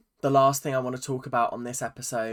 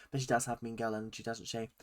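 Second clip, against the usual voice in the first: catty aside; 3 semitones lower, 7 dB quieter, faster than usual. Retold or imitated. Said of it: "went to Ali".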